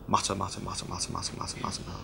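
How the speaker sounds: low, soft under-the-breath mutter; muttering demo. Shown as a demonstration. Preacher demonstrating the word mutter to his congregation, muttered low. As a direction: mumble it under your breath to show the sound. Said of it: "It sounds like the thing it's describing".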